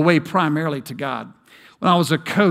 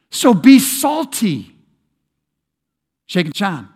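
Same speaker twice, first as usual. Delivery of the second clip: pastor booms the call to be salty at the congregation, preaching at full force; 3 semitones higher, 7 dB louder than usual. Acted. Preacher building to climax.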